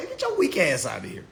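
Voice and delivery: normal talking voice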